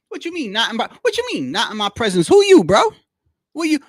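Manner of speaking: loud, high disbelieving challenge; indignant outburst. Real, not acted. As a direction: fire back in outraged disbelief. Indignant reaction to the quote.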